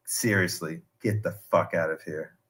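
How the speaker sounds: low, quiet, deadpan dismissal; retold low-key order; quietly serious. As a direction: imitate a leader calmly telling followers off, low and soft. Voices cult leader dismissing followers.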